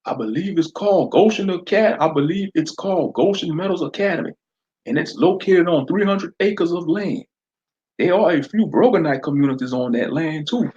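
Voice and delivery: deputy filling the sheriff in; informative deputy. Acted. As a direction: report the facts to the boss. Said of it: "Deputy Stockstill added".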